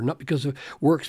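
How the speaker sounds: quick, throwaway reading of the phrase; hurried, offhand contrast take; rushed wrong version. Shown as a demonstration. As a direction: rattle off "Not because of works" as the version Paul doesn't say.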